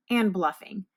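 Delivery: usual speaking voice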